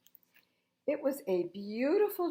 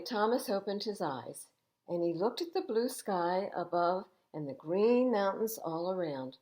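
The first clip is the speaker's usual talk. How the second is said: ordinary delivery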